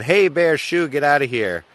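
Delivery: loud, high shout to scare it off; shooing a bear. Retold or imitated. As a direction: yell at the bear to shoo.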